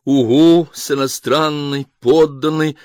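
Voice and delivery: ordinary delivery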